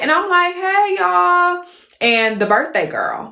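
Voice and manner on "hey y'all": high, sing-song hello; sociable greeting. Retold or imitated. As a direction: greet the whole table in a lilt. Acts out own greeting back.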